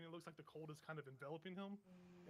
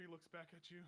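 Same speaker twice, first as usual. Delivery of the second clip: usual speaking voice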